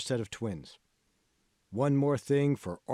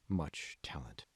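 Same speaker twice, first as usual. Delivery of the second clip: whisper; 9 semitones lower, 9 dB quieter, slower than usual. Acted. Mentor trails into whisper.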